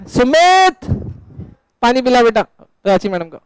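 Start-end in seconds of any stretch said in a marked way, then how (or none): 0.1-0.8 high called-out name across the room; calling out. Real, not acted. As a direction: summon Sumit from the back of the class. (ऊँची आवाज़ में सुमित को पुकारना)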